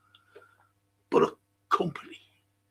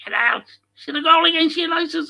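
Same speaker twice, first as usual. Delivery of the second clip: giddy fan voice, pitched up high; hammed-up fan rising higher and louder; mock gushing fan; 12 semitones higher, 4 dB louder than usual. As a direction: send up the fans raving about a goal. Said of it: "the fans were like".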